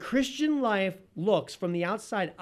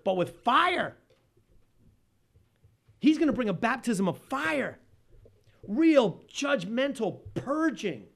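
louder, building preacher's cry; fiery preaching; 3 semitones higher, 6 dB louder than usual. Acted. Preacher proclaims to the congregation the coming baptism of fire, fervent.

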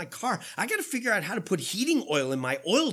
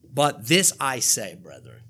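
solemn apostle; 10 semitones lower, 4 dB louder, slower than usual. Acted. Paul's solemn apostolic pronouncement.

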